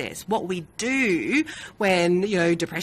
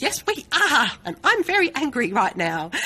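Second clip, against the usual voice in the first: mock cross reply, higher and louder; retold angry reply; 7 semitones higher, 4 dB louder than usual. Mother retelling her reply to Sonny, mock angry. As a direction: replay the telling-off half in jest. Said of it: "And I was like".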